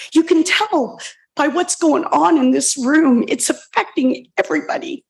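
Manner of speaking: ordinary delivery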